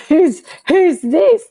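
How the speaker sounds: high, slowed, startled asking; jolted, asking who spoke; startled question. Retold or imitated. Caught off guard by a voice inside her, startled.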